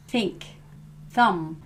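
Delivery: "Think" "thumb" with air through tongue and teeth; unvoiced th. Shown as a demonstration. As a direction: push air on the th. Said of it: "you push the air between your teeth and your tongue".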